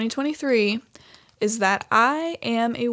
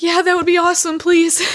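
higher and louder, eager; grateful yes; 8 semitones higher, 8 dB louder than usual. Retold or imitated. Accepting the stylist's offer to blow dry, eager thanks. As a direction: gush with relief and thanks.

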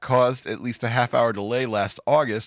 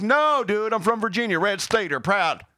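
proud Virginian; 10 semitones higher than usual. Pretend. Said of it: "It's like".

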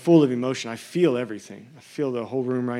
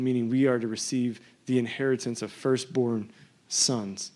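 ordinary delivery

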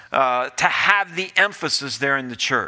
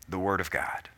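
hushed whisper; 10 semitones lower, 11 dB quieter than usual. Real, not acted. Preacher answers own question hushed.

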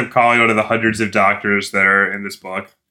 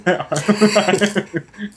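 burst of laughter; 10 semitones higher than usual. Real, not acted. Laughing at "episode one of ten.

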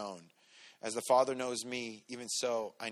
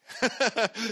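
burst of laughter; 10 semitones higher, 7 dB louder than usual. Real, not acted. Laughing at daughter's reaction.

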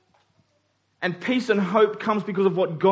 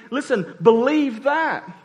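urgent exhortation; 6 semitones higher, 4 dB louder, slower than usual. Retold or imitated. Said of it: "Paul is saying".

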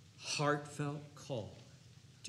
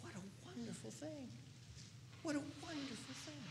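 hushed awe; 11 semitones higher, 14 dB quieter than usual. Real, not acted.

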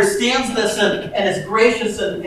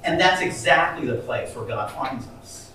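usual speaking voice

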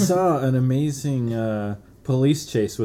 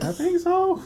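much higher, squeaky reply; slower than usual. Retold or imitated. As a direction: squeak it like a kid. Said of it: "And we were like".

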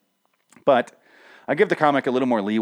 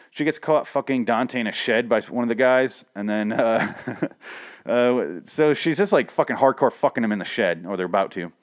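ordinary talk, nothing marked

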